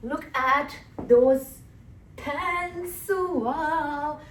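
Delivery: high, drawn-out gush; gushing admiration. Real, not acted. Vlogger showing off her beautiful pants to viewers, gushing. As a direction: stretch every word in wowed delight, pitch climbing.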